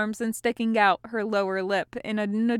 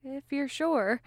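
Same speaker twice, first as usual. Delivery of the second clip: higher, tentative voice; 5 semitones higher than usual. A narrator's reading. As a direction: offer it gently, unsure. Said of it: "Hawk said tentatively".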